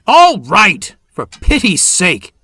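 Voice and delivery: high, loud exasperated shout. A narrator's reading. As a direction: snap at him, losing patience. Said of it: "the signalman shouted".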